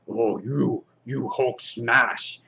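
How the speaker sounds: lightly voiced slow, lumbering brute; Hulk. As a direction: drag out the words like a comic-book brute. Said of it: "And then Hulk is like".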